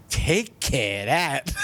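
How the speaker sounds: slow, drawn-out mocking repeat with a laugh in it; incredulous echo. Half-asleep friend pops up at the Jersey phrase, incredulous echo. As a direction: echo the phrase in stretched-out disbelief. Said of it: "Gaffney's head pops up like a gopher".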